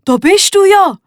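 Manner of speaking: high, loud relieved call. A narrator's reading. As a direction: burst out in relief. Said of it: "ruft der Röbi erleichtert".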